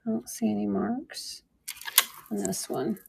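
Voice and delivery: breathy whispered aside. Real, not acted. Checking the cone for marks, a whispered aside. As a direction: whisper it to yourself while inspecting.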